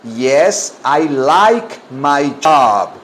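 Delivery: very slow, word-by-word model; deliberate, drawn-out drill; slow repeat-after-me. Shown as a demonstration. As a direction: stretch every word for learners to copy.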